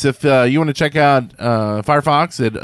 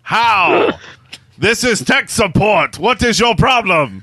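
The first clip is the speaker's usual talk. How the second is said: mock Indian tech-support accent, a notch higher; 6 semitones higher, slower than usual. Sponsor asks [name] for an Indian accent, hammed-up Indian accent. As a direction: ham up an Indian call-centre voice. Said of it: "say the following message in an Indian accent".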